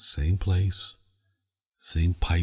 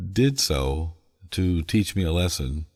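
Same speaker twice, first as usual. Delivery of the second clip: usual speaking voice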